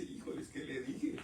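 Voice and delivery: hushed worried mutter; nervous aside. Retold or imitated. Believer who just spoke panics quietly, hushed and unvoiced. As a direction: whisper it to yourself in alarm.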